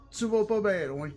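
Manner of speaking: ordinary delivery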